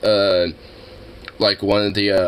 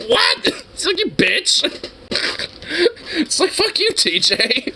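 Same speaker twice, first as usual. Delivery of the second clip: much higher, laughing fake outrage; mock outrage. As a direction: curse a friend through laughter.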